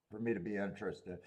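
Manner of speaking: talking through hiccups. Real, not acted. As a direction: hiccup mid-sentence. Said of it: "I got a hiccup right now".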